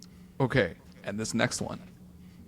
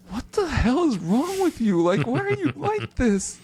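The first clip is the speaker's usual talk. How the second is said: higher, slow, drawn-out disbelief at the fans; incredulous outburst; 9 semitones higher, slower than usual. Retold or imitated. Retelling a reply to Orioles fans, a mock incredulous outburst. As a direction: stretch it out like you cannot believe them.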